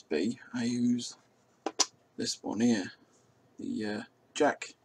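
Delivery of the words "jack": normal talking voice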